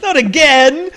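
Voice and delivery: loud high cry of dismay; exasperated shout. Pretend. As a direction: yell it in mock despair. Playing the exasperated homeowner.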